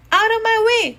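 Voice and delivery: high, loud bossy holler. A narrator's reading. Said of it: "she hollered".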